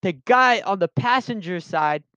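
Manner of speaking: usual speaking voice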